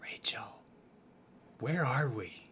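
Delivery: hushed whisper of a name. Acted. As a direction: whisper it urgently. Calling quietly to companion.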